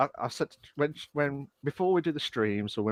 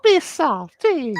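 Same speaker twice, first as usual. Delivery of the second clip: high, louder cooing voice; cheek-pinching baby talk; 13 semitones higher, 5 dB louder, slower than usual. Pretend. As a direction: coo it like pinching a baby's cheek. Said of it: "you just grab someone's cheek".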